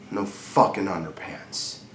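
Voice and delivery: low, hushed, brooding close; quiet bitter disbelief. Acted. Husband brooding on his wife's exposure, quiet and bitter. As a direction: drop right down and let it sink in.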